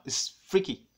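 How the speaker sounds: usual speaking voice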